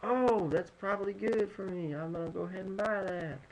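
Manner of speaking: a playful hint of a Texas shopper; Texas accent. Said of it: "I just did kind of a Texas accent".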